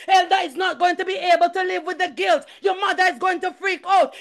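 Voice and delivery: high-pitched, urgent preaching; impassioned church testimony; fervent testimony. Real, not acted. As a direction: testify with rising fervour to the congregation. Fervent preaching of racing thoughts.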